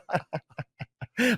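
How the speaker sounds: high-pitched burst of laughter; helpless high giggle. Real, not acted. Laughs at his own joke.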